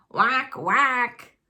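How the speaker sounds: quacked like a mother duck; a duck's whack whack; duck call. Acted.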